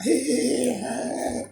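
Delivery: high, mocking sing-song hey; high taunting jeer. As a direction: imitate the lads jeering at you. Mimicking workers laughing at him.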